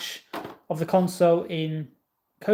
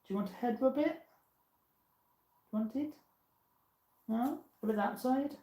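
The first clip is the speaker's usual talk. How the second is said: talking to a pet; 5 semitones higher, 7 dB quieter than usual. Real, not acted. Talking softly to the dog.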